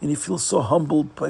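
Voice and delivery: ordinary delivery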